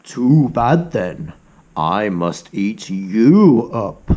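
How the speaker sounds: low, slow sinister cat; slow low villain cat; menacing cat. Acted. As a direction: drag out the threat. Cat threatens the rat.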